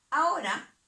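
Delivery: a sudden loud, high "Ahora" to grab the class; loud attention call. Real, not acted. Teacher snapping pupils to attention, loud call. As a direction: call the class back to the task.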